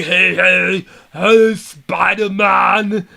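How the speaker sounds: slow, drawn-out mock Russian villain, drifting into Arnold; stretched-out, hammy Russian-Arnold accent; bad Russian accent. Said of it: "that was a bad Arnold".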